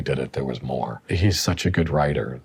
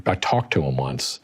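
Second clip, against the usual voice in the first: ordinary talk, nothing marked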